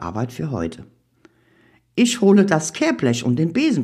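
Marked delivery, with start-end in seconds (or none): 1.9-3.8 lightly voiced papa, raised pitch; papa suggesting. A narrator's reading. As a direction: offer help in a higher voice. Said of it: "schlägt Papa vor".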